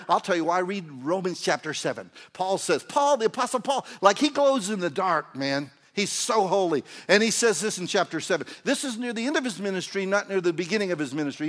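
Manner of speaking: loud, high, fired-up sermon; excited pulpit delivery, pressing on; full-force preaching. Real, not acted.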